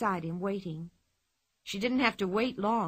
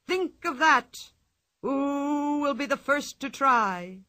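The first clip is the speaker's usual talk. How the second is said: circus ringmaster; 2 semitones higher than usual. Acted. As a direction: tempt the crowd with the prize. Ringmaster challenging the audience.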